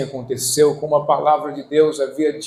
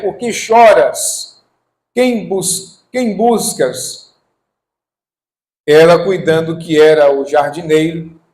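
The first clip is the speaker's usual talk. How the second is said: slow, loud, raised-pitch declamation; scripture reading; 4 semitones higher, 8 dB louder, slower than usual. Acted. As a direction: proclaim the Bible dialogue phrase by phrase. Reads scripture aloud to congregation.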